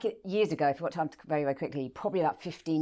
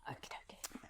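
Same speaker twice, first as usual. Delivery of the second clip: whispered aside; 26 dB quieter than usual. Real, not acted. Aside before the love letter.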